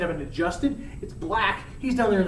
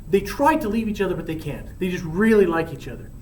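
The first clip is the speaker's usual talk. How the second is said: usual speaking voice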